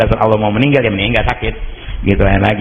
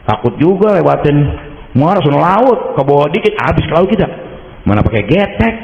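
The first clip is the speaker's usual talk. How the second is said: raised, anxious retelling of the scary crossing; retold fear; 7 semitones higher than usual. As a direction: voice lifts with remembered fear. Afraid crossing sea on raft. (ketakutan, suara naik)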